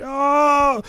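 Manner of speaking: high, sustained mock wail; long high comic cry; falling victim's cry. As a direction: imitate someone dropping into a pit. Imitates victim falling into the pit.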